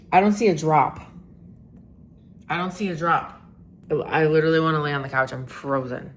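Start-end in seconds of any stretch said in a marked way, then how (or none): 0.1-1.0 exasperated. Real, not acted. Exasperated repeat about weather.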